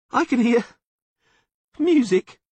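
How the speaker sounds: high, loud acted sob; sobbing. A narrator's reading. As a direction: wail it in a sudden sob. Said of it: "Dandelion suddenly sobbed".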